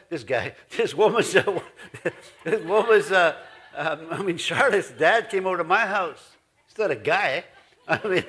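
real giggles breaking through the words; laughing through an embarrassed correction. Real, not acted. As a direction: laugh at your own slip while talking. Laughing at own slip.

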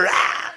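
mock roaring yell; big acted-out "rah" scream. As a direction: top the other yell with a roar. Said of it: "and I yelled louder".

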